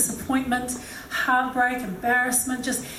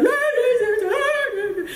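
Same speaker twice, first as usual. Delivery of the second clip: laughing sob; 12 semitones higher, slower than usual. Retold or imitated. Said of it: "I was like".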